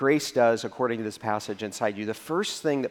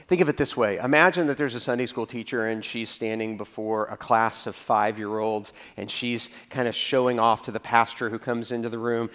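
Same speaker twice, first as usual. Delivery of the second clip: ordinary talk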